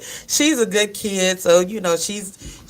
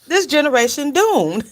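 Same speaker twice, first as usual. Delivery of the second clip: higher, louder mock verdict of doom; 7 semitones higher, 3 dB louder than usual. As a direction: pronounce the generation doomed in jest. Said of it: "She said".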